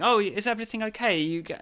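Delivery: lightly mimicked fussing host; imitating the organiser. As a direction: put on a checking-in organiser's voice. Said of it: "the organizer sort of going".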